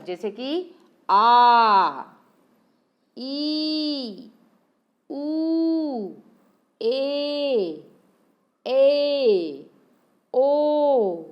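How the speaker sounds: आ, ई, उ, ए, ओ each drawn out long and held; long vowel demo. Shown as a demonstration. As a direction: stretch every vowel like a held note. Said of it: "हमारे दीर्घ स्वर". (दीर्घ स्वर खींचकर बोलें)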